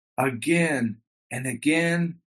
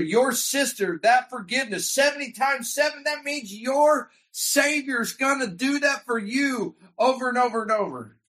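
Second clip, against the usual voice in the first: much higher, near shout, pressing the point; fervent preaching; 7 semitones higher than usual. Acted. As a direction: preach the promise with force. Preaching climax on mercy.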